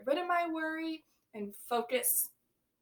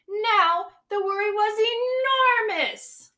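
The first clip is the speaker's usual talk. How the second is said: slow, louder, stretched for effect; dramatic enormous; 9 semitones higher, 12 dB louder, much slower than usual. A narrator's reading. Teacher wowing the class, slow and loud with drama. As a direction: make enormous sound huge.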